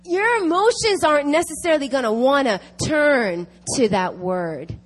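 fervent preacher piling up examples; impassioned preaching. Real, not acted. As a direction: drive the point home to the congregation. Preaches with raised intensity.

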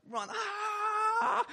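long, very high yell on the run; hammed-up scream charging into battle; charging battle shout. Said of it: "just so I can shout".

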